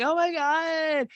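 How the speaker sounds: high, drawn-out gushing squeal; mock squeal. Host imitating a tipsy guest greeting a friend, mock squeal.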